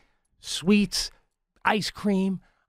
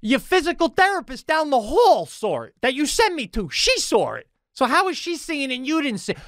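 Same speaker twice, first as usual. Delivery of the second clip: mock indignant; 13 semitones higher than usual. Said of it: "I go".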